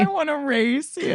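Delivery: mocking childish voice. Friend mimics her taunt.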